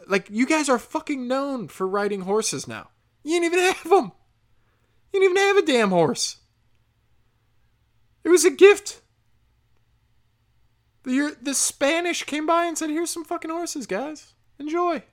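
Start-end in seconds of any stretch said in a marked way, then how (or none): 3.2-9.0 higher and louder, jeering yell; loud mocking rant; scornful shout. Real, not acted. Ranting about horses. 12.9-15.0 higher, a breezy handing-over voice; lightly voiced giver of gifts; hammed-up casual offer; mock generous Spaniard. Mocking Spanish handing over horses.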